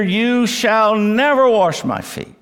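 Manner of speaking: high, forceful vow of refusal; emphatic refusal. Acted. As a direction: declare it as absolutely out of the question. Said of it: "this is so emphatic".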